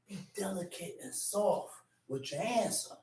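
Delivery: soft breathy whisper. Real, not acted. Preacher advising a soft answer, whispered. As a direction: whisper it gently, as advice.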